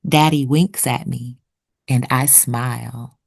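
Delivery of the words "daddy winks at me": plain reading voice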